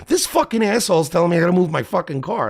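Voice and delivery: high, heated griping; acted outraged complaint. Renter complaining to his friend about stranger, high outraged rant.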